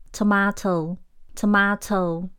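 slow British "tomato" with a long ah; British pronunciation. Shown as a demonstration. Teacher models the fifth word, British accent. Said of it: "让你读完三分钟后变英国人".

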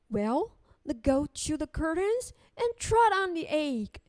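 plain reading voice

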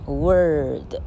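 Word drawn out as "werrrd"; slow, curled vowel in Word; long r-coloured vowel. Shown as a demonstration. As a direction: linger on the "er" in Word. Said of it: "也是这样念".

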